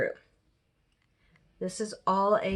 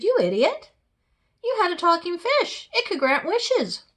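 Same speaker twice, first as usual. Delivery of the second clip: high, loud exasperated wife; scolding wife; 9 semitones higher, 5 dB louder than usual. Acted. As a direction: berate a foolish husband at raised pitch. Wife scolds fisherman in story.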